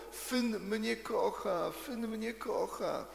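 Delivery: put-on little girl dancing around a lamppost, calling out with joy; joyful shouting. A narrator's reading. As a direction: crow it out with delight, stretching every word. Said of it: "zaczęła krzyczeć dosłownie na całe gardło".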